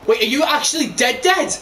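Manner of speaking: high-pitched incredulous question; startled, pitch leaping at the end; real disbelief. Real, not acted. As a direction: ask it aghast at a teammate's death. Shocked that teammate has died.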